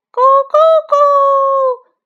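high, loud, slow cock-a-doodle crow; imitated rooster crowing. Shown as a demonstration. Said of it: "公鸡的啼鸣".

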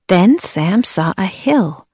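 plain reading voice

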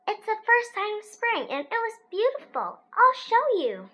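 high, bright and eager; excited child. A narrator's reading. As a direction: gush it like a thrilled kid.

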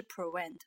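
prevent mispronounced as pre-went; V turned into W. Shown as a demonstration. Teacher showing what to avoid, W for V in prevent. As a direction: swap the V in prevent for a rounded W. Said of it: "不是prevent".

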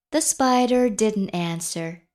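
ordinary delivery

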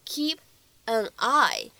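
KEEP, AN, EYE said as separate words. Shown as a demonstration. Teacher showing learners the single words, word by word. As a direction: pause between "keep" and "an eye", unlinked. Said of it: "这样的三个单词呢放在一起".

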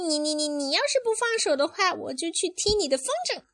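very high, fast, stammering little sister; squeaky, spluttering rapid threat; Rosie. Acted. Parent voicing the sister's comeback, high and fast. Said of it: "Rosie说".

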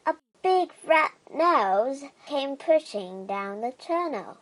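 usual speaking voice

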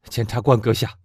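put-on loud, high, fervent young man; impassioned young man. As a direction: demand revenge with burning passion. Morrel addresses prosecutor. (激昂的青年)

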